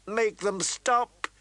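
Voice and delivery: high, slow, tearful plea; crying. Acted. Catelyn begging her son through tears. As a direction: sob it out, pleading. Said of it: "she cried".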